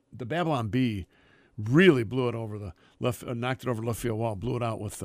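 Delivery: usual speaking voice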